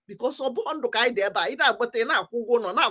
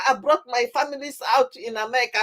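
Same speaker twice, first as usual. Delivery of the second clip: ordinary talk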